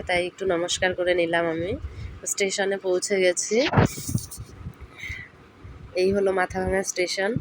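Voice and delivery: ordinary delivery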